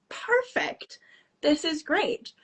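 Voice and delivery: high-pitched, pleased "perfect"; pleased reply. Retold or imitated. Storyteller replaying her reply to Jill's offer, pleased and high.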